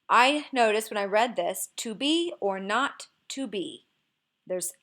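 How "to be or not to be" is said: slow "To be or not to be", leaning on "be", "not" and final "be"; stress on be, not, be. Shown as a demonstration. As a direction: punch "be", "not", "be" in measured iambs. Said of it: "emphasis on the words be, not, and be".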